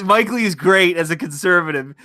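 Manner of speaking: normal talking voice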